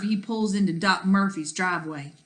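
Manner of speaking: ordinary delivery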